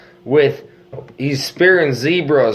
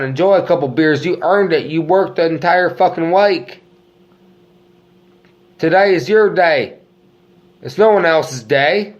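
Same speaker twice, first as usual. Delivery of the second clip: rousing pep talk; 5 semitones higher, 3 dB louder than usual. Acted. Pep-talk weekend send-off.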